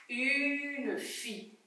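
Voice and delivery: soft, slow, with "Une" drawn out; stretched-out word. Shown as a demonstration. Teacher models the word for pupils, stretched-out word. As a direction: quietly sound out the word at a crawl. Sounding out words to hear F.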